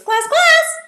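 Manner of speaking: very high, loud double call; high-pitched. Shown as a demonstration. Teacher calling the class twice for giggles, high-pitched. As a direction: squeal both words way up high. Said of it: "say it in a high-pitched voice".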